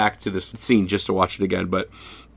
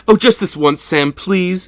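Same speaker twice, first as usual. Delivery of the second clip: higher, pleading woman's voice; pleading secretary; 9 semitones higher than usual. Retold or imitated. Secretary begging the detective for a kiss, higher pleading. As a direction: beg sweetly, lightly hammed. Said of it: "And she goes".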